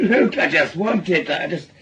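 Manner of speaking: said through a laugh. Acted. Man laughing off his friend's worry about the gun, laughing.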